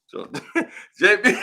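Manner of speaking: ordinary talk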